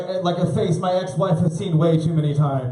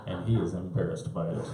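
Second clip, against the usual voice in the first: put-on hushed conspiratorial kid; 7 semitones lower, 10 dB quieter than usual. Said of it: "She's like".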